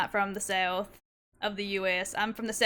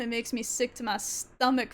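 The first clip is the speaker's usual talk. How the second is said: ordinary talk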